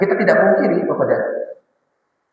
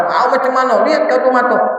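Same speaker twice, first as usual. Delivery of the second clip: higher, scolding the other rider; mock angry driver; 8 semitones higher than usual. Preacher voicing a rider scolding another driver, mock angry. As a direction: snap at him to use his eyes.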